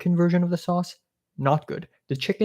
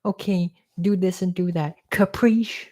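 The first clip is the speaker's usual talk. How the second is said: mock bossy host; 4 semitones higher than usual. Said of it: "she's like".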